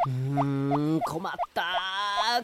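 a polite talking turtle, worried and lost; lost turtle, fretting. Acted. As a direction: fuss to yourself like a lost little turtle.

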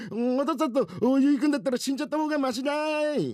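put-on very high, bawling child voice; wailing little boy. Son refuses bathing with father, wailing. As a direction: wail in protest like a small boy.

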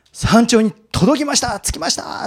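excited, high and loud triumphant announcement; 歓喜の到着報告. Acted. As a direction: call it out to a watching audience, elated. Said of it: "っていうふうにね、手を振って". (興奮して叫ぶ登頂報告)

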